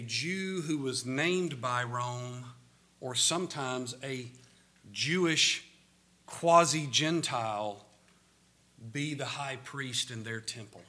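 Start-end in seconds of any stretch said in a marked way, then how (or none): none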